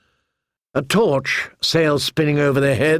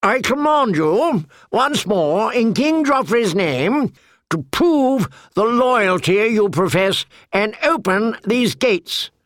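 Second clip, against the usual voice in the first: higher, formal command; commanding knight; 8 semitones higher than usual. A narrator's reading. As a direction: order them in the king's name. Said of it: "said Sir Amory".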